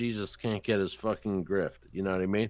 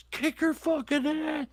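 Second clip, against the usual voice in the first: mock cheering; 3 dB quieter than usual. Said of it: "I'm like".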